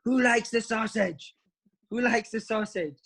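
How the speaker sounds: high, slow mock yell at the players; imitated scream. Manager yelling at players about sausages, high, slow mock scream. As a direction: bellow the question high and drawn out like a furious manager. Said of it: "he starts screaming at a group of guys".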